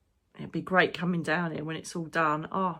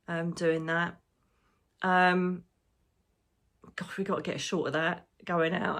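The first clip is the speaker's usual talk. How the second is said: normal talking voice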